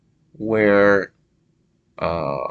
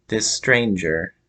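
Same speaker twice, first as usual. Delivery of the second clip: ordinary talk, nothing marked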